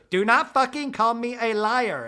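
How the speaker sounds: Whitney warns Lisa not to call her a liar, mock shout; imitated furious shout. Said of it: "And Whitney goes".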